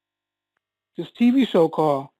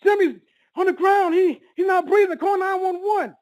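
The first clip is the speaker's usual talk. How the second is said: retold scream; 10 semitones higher than usual. Son calling his mom to his collapsed father, retold scream. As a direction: re-enact a frightened child's call for help. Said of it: "I screamed to my mom".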